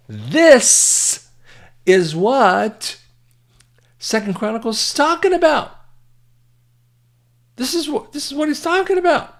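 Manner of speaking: high-pitched, emphatic preacher's cry; impassioned preaching. Real, not acted. Preaches at full force.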